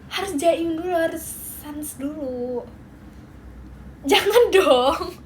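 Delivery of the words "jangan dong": slow, loud laughing protest. Real, not acted. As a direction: laugh through the words.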